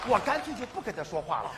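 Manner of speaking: usual speaking voice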